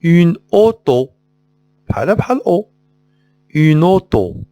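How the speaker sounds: ordinary delivery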